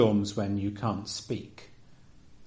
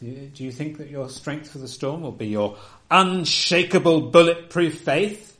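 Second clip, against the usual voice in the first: mock challenge; 5 semitones higher, 6 dB louder than usual. Real, not acted. Ironic challenge to the congregation.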